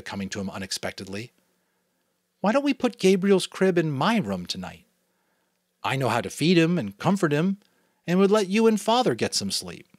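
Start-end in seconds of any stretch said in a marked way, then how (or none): 2.4-9.9 put-on higher, eager young voice asking parents; a boy hopefully making his case; lightly voiced eager boy pleading; eager boy's offer. Jonas offers to take crib.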